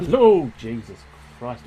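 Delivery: normal talking voice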